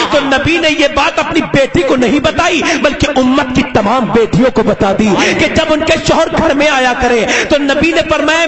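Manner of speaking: ordinary talk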